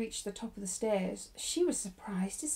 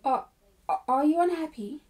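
higher, louder, stumbling question; blurted in a rush; 5 semitones higher, 6 dB louder than usual. A narrator's reading. Shy Ermengarde reaching for something intimate, blurting. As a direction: blurt it out awkwardly after a false start. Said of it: "she said in a rush".

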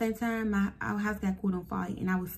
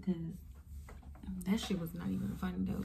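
soft serious aside; 8 dB quieter, slower than usual. Real, not acted.

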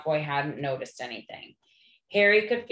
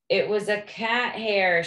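higher, louder wail; howled; 4 semitones higher, 4 dB louder than usual. Acted. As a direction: wail it out in distress. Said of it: "she howled".

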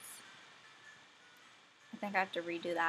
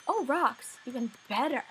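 excited; 4 semitones higher, 8 dB louder than usual. Real, not acted. Finding rocks in game, delighted.

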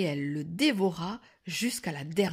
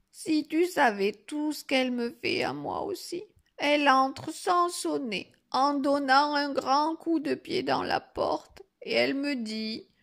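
higher, complaining granny voice; lightly voiced old grandmother; 7 semitones higher than usual. Grandmother tells the wolf of her woes, mildly plaintive. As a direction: grumble a little as an old woman.

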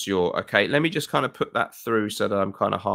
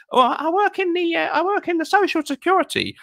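much higher hesitant woman's voice; contestant woman; 4 dB louder than usual. Retold or imitated. Nervous contestant answering the host, much higher and halting. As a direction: stumble shyly as a nervous contestant. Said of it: "this one woman".

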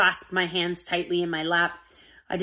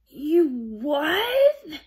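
choked, much higher shock; 9 semitones higher, 3 dB louder than usual. Acted. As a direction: squeeze it out in shock. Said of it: "I finally choked out".

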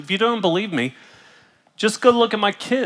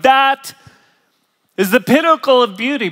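a hammed-up awestruck gasp, higher and louder; awed exclaim; 7 semitones higher, 8 dB louder than usual. Pastor mimicking a listener gushing over beauty, mock awe. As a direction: gush over it as if it's the peak.

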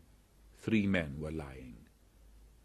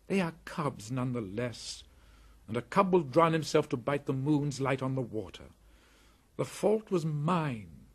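put-on young boy voice; 7 semitones higher, 5 dB louder than usual. Mowgli takes the blame.

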